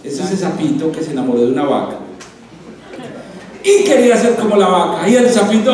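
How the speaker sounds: normal talking voice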